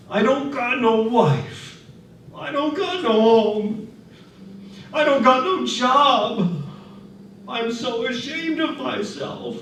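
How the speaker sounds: a hint of tears as he admits his shame; sobbing laborer. A narrator's reading. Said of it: "the big man began to sob".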